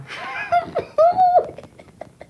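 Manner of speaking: high, loud laughing squeal; high laughing whoop. Real, not acted. Student recalling her first flexibility class, high laughing whoop.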